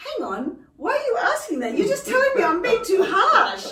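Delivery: high, laughing indignant protest; laughing mock protest. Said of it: "And I feel like saying".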